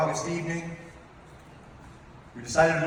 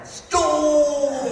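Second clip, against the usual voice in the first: high, loud yelled Stole!; mock fan yell; 12 semitones higher, 6 dB louder than usual. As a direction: bark it like a rowdy football fan. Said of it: "They yell out stupid things like".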